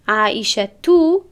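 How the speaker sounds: slow عایشه تو, the ending a plain -tu with no n; teacher drawls عایشه تو to show the missing نون; ending without tanwin. Shown as a demonstration. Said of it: "من برای چی تون نگفتم". (عایشه تو آهسته، بدون تنوین)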